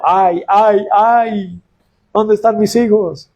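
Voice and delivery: lamento agudo de La Llorona, imitado con suavidad. Retold or imitated. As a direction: llora a sus hijos con un quejido alto y prolongado. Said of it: "La Llorona, que todo el tiempo anda gritando". (imitación ligera de La Llorona gritando por sus hijos)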